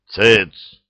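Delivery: sharp loud bark. Acted. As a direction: bark it to silence them. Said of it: "рявкнул десятник".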